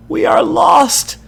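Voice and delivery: very high, loud, slow terrified cry; a second child screaming from inside the house; child's scream. A narrator's reading. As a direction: shriek in terror like a child. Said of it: "the second child screams".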